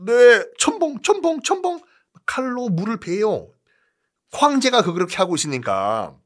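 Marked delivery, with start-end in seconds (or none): 0.1-1.8 reluctant soldier voice. Acted. Soldier obeying.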